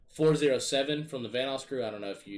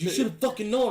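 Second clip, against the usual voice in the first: irritated yell; 10 semitones higher, 4 dB louder than usual. Retold or imitated. Jay's retort in retold argument.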